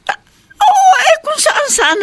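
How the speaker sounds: very high, loud wailing; distraught crying. Acted. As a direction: break into a worried wail. Distraught on phone. (umiiyak sa pag-aalala)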